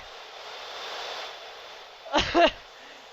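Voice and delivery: high breathless wheeze; wheezing laugh. Real, not acted. Hysterical laughter.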